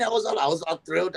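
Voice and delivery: ordinary talk, nothing marked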